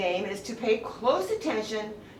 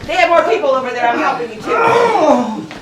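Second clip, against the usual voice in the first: shouting; 6 semitones higher, 11 dB louder than usual. Real, not acted. Calling out during tug of war.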